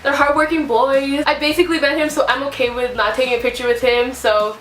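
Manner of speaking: usual speaking voice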